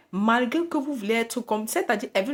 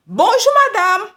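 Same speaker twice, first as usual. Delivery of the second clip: high and much louder hello; greeting again, now loudly; loud insistent greeting; 11 semitones higher, 11 dB louder than usual. Retold or imitated. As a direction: call it out so she can't ignore it. Greets louder, maybe unheard.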